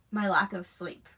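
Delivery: usual speaking voice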